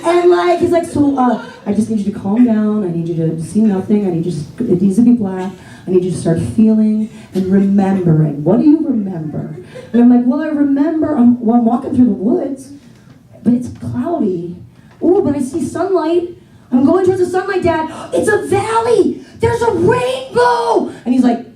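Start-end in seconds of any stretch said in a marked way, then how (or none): 0.8-9.6 put-on a low, hushed guru talking his kid into a trance; dad's meditation voice. Dad hypnotising his kid, in a low, soothing guru voice. 10.3-20.9 put-on a kid seeing a vision, growing ever more amazed; building kid wonder. Child telling dad her vision, building from hushed to an awed shout.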